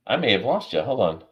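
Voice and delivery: ordinary delivery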